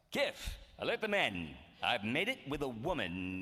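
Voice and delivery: slow, pompous blowhard captain voice; Zapp Brannigan. Retold or imitated. Zapp bragging to Kif, slow pompous blowhard. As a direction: deliver it like a vain starship captain. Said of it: "where Zapp would say".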